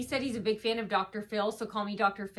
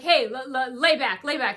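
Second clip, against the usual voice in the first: rude tone; 2 semitones higher, 6 dB louder than usual. Retold or imitated. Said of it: "in a really rude tone".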